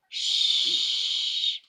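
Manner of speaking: breathy shush; teacher shushing. A narrator's reading. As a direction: hush the class.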